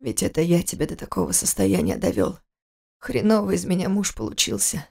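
тихий, низкий мужской голос, горечь; муж берёт вину на себя; виноватый муж. Acted. (тихо и низко, с горечью)

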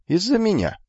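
high, proud boast. Acted. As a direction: own it proudly. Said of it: "гордо ответил Лихас".

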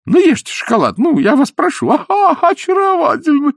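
high, loud gushing flatterer; fawning high voice. Acted. Изнуренков fawning over the queen, high gushing voice. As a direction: gush over her like a fawning fool.

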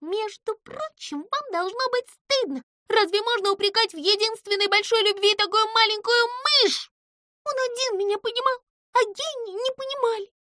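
high squeaky voice, sulky and rushed; a little mouse defending her love; indignant mouse. A narrator's reading. Pimpochka complains.

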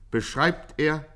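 normal talking voice

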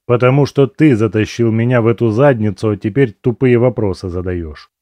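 ordinary delivery

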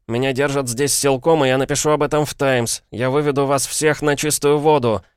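высокий, напористый крик возмущения; перебить и обвинять, резко повысив голос; гневный вопль. A narrator's reading. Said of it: "перебил учителя воплем". (возмущённый вопль)

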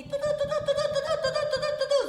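high, loud mocking "tu, tu, tu"; mocking chatter. Worker mocking her colleague's nagging, high and loud. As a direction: parrot his endless nagging.

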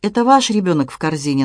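ordinary delivery, nothing marked

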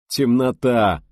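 contemptuous snort. A narrator's reading. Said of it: "презрительно фыркнул Дмитрий".

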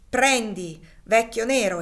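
a master shouting at his slave, loud and furious; angry shout. A narrator's reading. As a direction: yell it as you raise the whip. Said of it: "esclamò poi".

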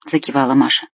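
ordinary delivery, nothing marked

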